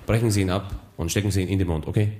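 low, calm veteran pilot voice; erfahrener Pilot. Acted. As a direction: reassure like an old flight captain. Pilot gibt Anweisung. (tiefe, ruhige Pilotenstimme)

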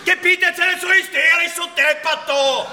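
high, loud exasperated shout; angry outburst. Acted. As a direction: snap at her to stop. Snapping at wife. (hoher, lauter, genervter Ausbruch)

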